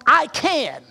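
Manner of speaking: "I can" with the weight pushed onto "I can"; stress on I CAN. Shown as a demonstration. Pastor shows congregation the misplaced stress on "I can". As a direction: lean on "I can" the wrong way. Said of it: "we put the emphasis on".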